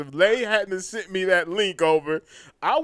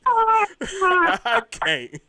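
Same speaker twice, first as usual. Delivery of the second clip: laughing; 12 semitones higher, 5 dB louder than usual. Real, not acted. Cracking up at the clip.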